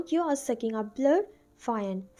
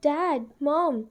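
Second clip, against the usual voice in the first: yelling loudly; 3 semitones higher, 3 dB louder than usual. A narrator's reading. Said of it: "Vian yelled loudly".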